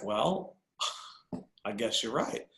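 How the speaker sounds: imitating a sheepish admission, low and soft; reluctant concession. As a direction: give in quietly after a hesitation. Sister conceding, acted out.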